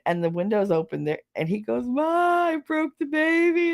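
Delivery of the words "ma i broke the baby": high-pitched imitation of a wailing man; hammed-up distraught cry to mom; mock crying wail. Crying man runs to tell his mother, mock wail. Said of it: "He's crying".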